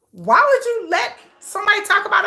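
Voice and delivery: high, appalled questioning; indignant disbelief. Real, not acted. Viewer appalled at what kids overhear, indignant. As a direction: demand to know how anyone allows it. Outraged at talk in front of kids.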